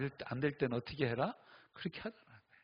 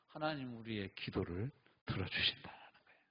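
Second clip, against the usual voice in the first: whispered; 3 semitones lower, 5 dB quieter than usual. Acted. After climax.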